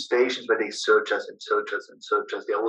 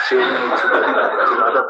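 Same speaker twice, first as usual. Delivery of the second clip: laughing; 5 dB louder, much faster than usual. Real, not acted. Laughs sharing food.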